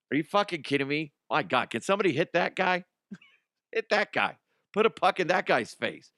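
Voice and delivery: indignant, pitch jumping up; exasperated rant. Real, not acted. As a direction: rant in disbelief at an online idiot. Outraged rant at the critics.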